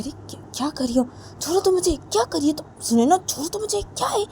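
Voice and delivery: put-on a shrill-sounding girl voice wriggling free; high squeaky protest. Simran pleading with Sona to let go, high and squeaky.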